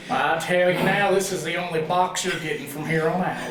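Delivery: lightly voiced sheriff. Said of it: "like our performance".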